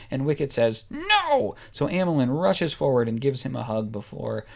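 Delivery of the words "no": high Ewok no. Acted. Wicket refuses hug.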